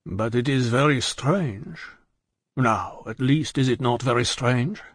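slow, gentle plea; mildly pleading. A narrator's reading. Defarge urges his wife to admit it, slow and mildly pleading. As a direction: draw it out, lightly pleading. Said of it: "rather pleading with his wife".

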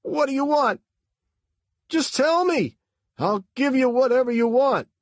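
high, loud panicked begging; high-pitched, loud, scared rush of words; frightened pleading. A narrator's reading. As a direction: plead for your life. Frightened driver at gunpoint.

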